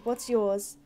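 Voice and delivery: normal talking voice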